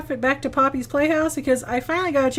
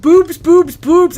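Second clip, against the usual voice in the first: loud, higher mimicked chant; imitated Mimic call; 6 semitones higher, 12 dB louder, much slower than usual. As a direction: blurt the repeated word like a loud mimic. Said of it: "And he heard boobs".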